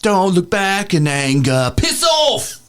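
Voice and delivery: high, slow, shouted British parody; mock British accent. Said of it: "in that stupid accent".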